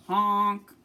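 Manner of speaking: loud honk like a goose; loud goose honk imitation. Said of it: "Gabby hooted".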